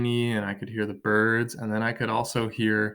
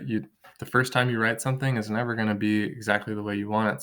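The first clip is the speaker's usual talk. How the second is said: ordinary talk, nothing marked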